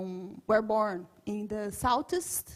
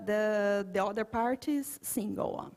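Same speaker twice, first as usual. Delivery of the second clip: ordinary delivery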